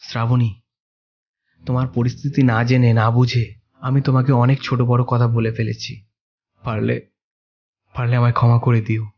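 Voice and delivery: husband softly asking his wife's forgiveness; soft apology. Acted. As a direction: ask forgiveness gently.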